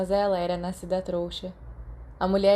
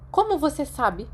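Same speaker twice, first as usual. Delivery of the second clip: curt, higher teacher voice; brusque question; 7 semitones higher, 3 dB louder than usual. A narrator's reading. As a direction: snap the question at the boy. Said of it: "ela perguntou bruscamente". (pergunta brusca de professora)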